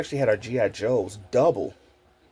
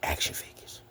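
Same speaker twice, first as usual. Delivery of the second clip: whispering; 11 dB quieter than usual. Real, not acted. Whispered punchline.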